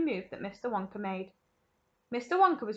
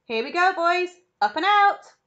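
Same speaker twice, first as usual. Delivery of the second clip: loud, high launch cry; rally the boys for takeoff; excited rallying call; 8 semitones higher, 11 dB louder than usual. A narrator's reading. Wonka announces the launch.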